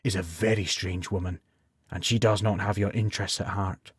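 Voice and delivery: low, soft inner voice; a quiet warning from inside his head; voice in his mind. A narrator's reading. His inner voice warns him about the crone, low and soft. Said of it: "said the voice in his mind".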